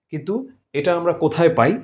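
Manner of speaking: usual speaking voice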